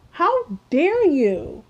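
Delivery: slow, high, indignant how dare you stretched out; outraged disbelief. Real, not acted. Outraged at slur defenders.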